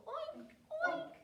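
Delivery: very high, squealed oinks; pig oinking. Pretend. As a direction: imitate a little pig. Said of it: "And pigs go".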